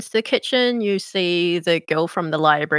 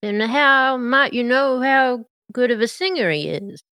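Mater voice; 5 semitones higher, 3 dB louder than usual. Acted. Mater questioning suspiciously.

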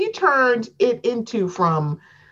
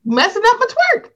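high, louder laughing put-on voice; mock housewife voice; 10 semitones higher, 5 dB louder than usual. Host hams up the twerking housewife for her cohost, high and laughing. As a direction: whine it as the offended twerker. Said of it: "I was trying to twerk".

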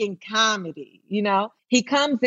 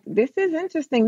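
mock thoughtful; 7 semitones higher, faster than usual. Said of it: "And I was like".

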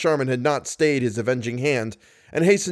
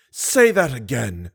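put-on menacing old woman; slower than usual. A narrator's reading. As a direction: dare him to repeat it. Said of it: "caught Mister Manager Richard a mighty box".

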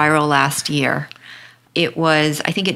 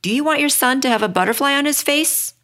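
mild accusing question, pitched higher; 7 semitones higher, faster than usual. Retold or imitated. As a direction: read the mom's accusing challenge to her husband, lightly. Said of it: "and says accusingly".

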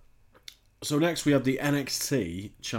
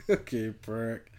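slow, savoring each word through laughter; drawn-out laughing insult; slower than usual. Retold or imitated. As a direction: stretch it out, amused. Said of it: "it tickled me big time".

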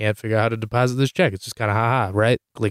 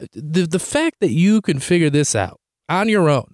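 higher, exasperated sputter; indignant disbelief, acted out; retold incredulity; 6 semitones higher than usual. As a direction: confront mom in mock outrage. Retold disbelief at mom using app.

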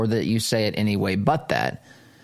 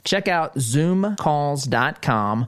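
ad read; 4 semitones higher than usual. Acted. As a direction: sell it like a friendly radio spot. Host reads sponsor ad.

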